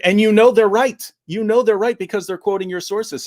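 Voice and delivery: quoting Yasser Qadhi. Retold or imitated.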